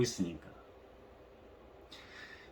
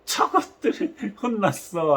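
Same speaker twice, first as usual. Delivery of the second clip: put-on higher, giggling through it as a delighted child; gleeful laughing; 6 semitones higher than usual. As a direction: laugh it out like a kid watching grown-ups get scolded. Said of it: "아주 재밌어 했을 것 같아요".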